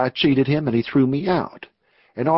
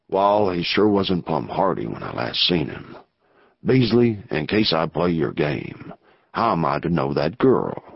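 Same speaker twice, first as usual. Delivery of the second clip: lower outlaw voice; 8 semitones lower than usual. Acted. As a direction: play the rough outlaw weighing the risk. Narrator voices outlaw Anson.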